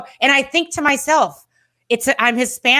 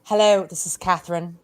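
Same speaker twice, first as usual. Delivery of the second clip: low put-on Catherine voice in an odd accent; lower, measured delivery, strange accent; strange unplaceable accent; 4 semitones lower than usual. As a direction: introduce yourself as an alter ego in a strange accent. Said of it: "speaking in some sorts of strange".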